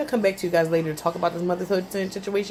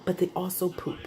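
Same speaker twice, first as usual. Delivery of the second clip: whispered aside; 8 dB quieter than usual. Real, not acted. Mother warning would-be parents, whispered. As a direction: drop to a conspiratorial whisper. Confiding the ugly side of babies.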